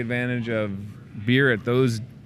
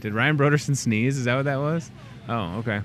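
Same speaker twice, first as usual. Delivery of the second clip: usual speaking voice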